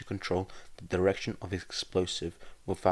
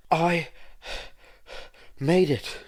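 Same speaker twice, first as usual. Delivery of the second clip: high, loud, out-of-breath gasps; panting breathlessly; 10 semitones higher, 7 dB louder, slower than usual. Acted. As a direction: push the words out between pants. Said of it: "Izuku panted out breathlessly".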